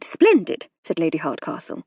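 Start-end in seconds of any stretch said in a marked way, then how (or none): 0.0-0.7 Splendid leapt up high and brighter; delighted. A narrator's reading. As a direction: lift the second splendid in delight. Second splendid lifts sharply.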